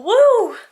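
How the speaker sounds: a high, loud "woo"; excited woo; happy cheer. Real, not acted. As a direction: whoop with excitement. Cheering before fresh school week.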